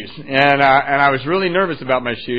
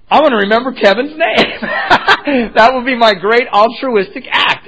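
giggling through the words, self-mocking; laughing at himself; 7 semitones higher, 7 dB louder than usual. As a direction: laugh out the noble plan. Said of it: "And I went".

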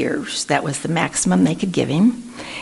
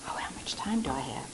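hushed aside; whispered aside; 5 semitones lower, 18 dB quieter, faster than usual. Real, not acted. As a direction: drop to a whisper. Aside about time.

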